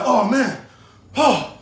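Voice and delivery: usual speaking voice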